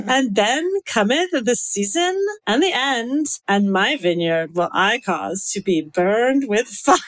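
put-on slow Valley girl, breaking into laughter; slow Valley girl questioning, with a giggle; Valley girl uptalk. Shown as a demonstration. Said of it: "do everything with a question at the end".

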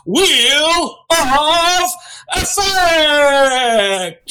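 loud, high, drawn-out announcer call; big hammed-up announcer voice, high and slow; game show announcer voice. Shown as a demonstration. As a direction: stretch every word out like a big game show reveal. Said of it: "let me do it with the voice".

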